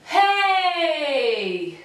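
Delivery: long loud hey, sliding down; projected hey. Shown as a demonstration. Coach calling hey with the class, loud. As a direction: toss the sound at the far wall. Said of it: "with me".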